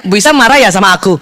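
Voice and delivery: lightly voiced husband probing. Husband asking his wife three minutes later, low and quick. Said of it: "Tiga menit lagi ditanya".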